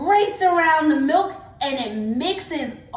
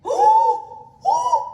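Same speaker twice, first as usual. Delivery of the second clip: very high gasping ooh with a laugh; 5 dB louder than usual. Real, not acted. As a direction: squeal with delight. Colors swirling again.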